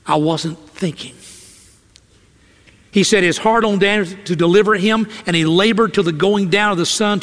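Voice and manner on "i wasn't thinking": regretful king. Acted. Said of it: "I can see the king now".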